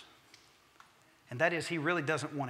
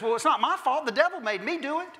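high, bright mock protest with a laugh; Eve's excuse; 10 semitones higher, 6 dB louder than usual. Eve to God, laughing it off, high mock innocence. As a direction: play Eve shrugging off the blame, laughing. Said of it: "she turns immediately and says".

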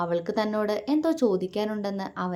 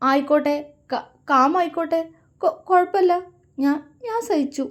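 high, louder, stammering through sobs; sobbing; 9 semitones higher, 6 dB louder than usual. Acted. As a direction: choke the words out in tears. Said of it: "അവൾ തേങ്ങലോടെ പറഞ്ഞു".

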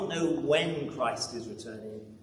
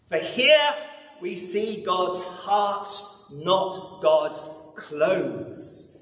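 loud high opening then slow, weighty words; emphatic proclamation; 3 semitones higher, 4 dB louder, much slower than usual. Real, not acted. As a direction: lean on each phrase to land the point. Preacher's climactic declaration.

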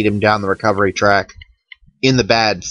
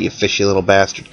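ordinary talk, nothing marked

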